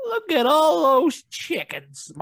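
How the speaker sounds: high, very slow, drawn-out mock voice; meme quote. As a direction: drag every word out up high. Repeating viral video meme.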